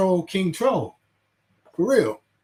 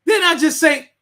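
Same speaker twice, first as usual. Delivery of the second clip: much higher and louder, half laughing at the chat; exasperated shout; 11 semitones higher, 6 dB louder than usual. Real, not acted. Streamer yelling at a chat commenter, exasperated shout. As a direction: yell it at the silly commenter.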